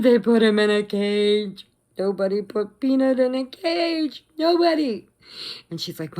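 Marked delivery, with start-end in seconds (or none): 0.0-5.1 high, loud wailing lament; hammed-up meltdown. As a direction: wail it like an exaggerated tearful breakdown. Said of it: "right in the middle of my meltdown".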